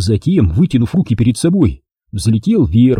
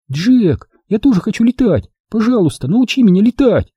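put-on high, begging girl's voice; pleading; 10 semitones higher than usual. A narrator's reading. Mia begs Jack to teach her flying, pleading. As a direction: beg eagerly, pitched way up. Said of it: "взмолилась Мия".

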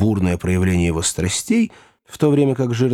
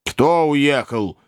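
slow, higher-pitched angry question; 5 semitones higher, much slower than usual. A narrator's reading. Master questioning servant about who left, angry. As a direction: demand it with a touch of anger, drawn out. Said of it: "сердито спросил мистер Уордль".

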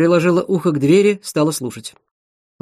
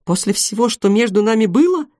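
high, wounded question; acted disbelief at a betrayal; astonished; 6 semitones higher than usual. A narrator's reading. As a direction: ask it pitched up, incredulous. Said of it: "удивилась Светлана".

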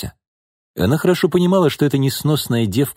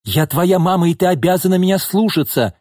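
high-pitched angry insistence; yelling; 9 semitones higher than usual. A narrator's reading. Mother demanding obedience from her daughter, yelling. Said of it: "кричала Нина".

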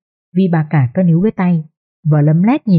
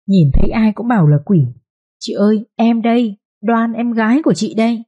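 sickly sweet coaxing; 3 semitones higher than usual. A narrator's reading. Second wife coos falsely at her sister while pinching, sweet. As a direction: coax her with honeyed, fake tenderness. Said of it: "cái giọng mới ngọt làm sao".

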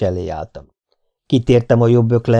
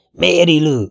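mild narrated shout, higher pitched; calling out loudly; 6 semitones higher, 3 dB louder than usual. A narrator's reading. Bartender calls to Mary Lou from the back, calling out. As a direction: call her name from the back, held in check. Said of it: "kiáltotta valaki hangosan hátulról".

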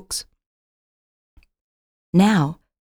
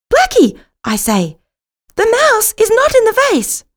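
high, loud urgent call; 13 semitones higher, 6 dB louder than usual. A narrator's reading. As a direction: shout the news to the cat in the vase. Narrator calls out to cat.

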